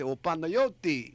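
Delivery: "O Panayoti" said slowly and loudly in Greek, stress on yo; Greek pronunciation. Shown as a demonstration. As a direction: call out "O Panayoti" as a Greek speaker would. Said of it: "the way you would say that in Greek".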